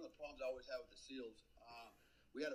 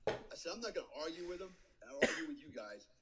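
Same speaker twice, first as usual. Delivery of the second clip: higher and louder, a hint of a fed-up commander; retold anger; 5 semitones higher, 4 dB louder than usual. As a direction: snap back at the interpreter, half-acted. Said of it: "And I was pissed now".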